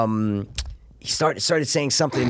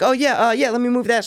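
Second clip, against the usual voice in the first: put-on nervous girl; 10 semitones higher than usual. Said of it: "kinda nervous".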